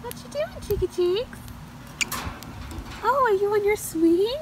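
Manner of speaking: high sing-song voice cooing to the birds. Real, not acted. Owner cooing at her parrots on their swing, high sing-song baby talk.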